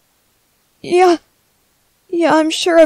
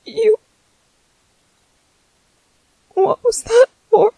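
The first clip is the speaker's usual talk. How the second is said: hurt and shocked; 5 semitones higher than usual. Acted. Struck by partner, voice cracks.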